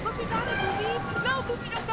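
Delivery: high, loud, urgent plea; urgent calling out. Real, not acted. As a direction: call out urgently to stop them leaving. Calling after teammate in game.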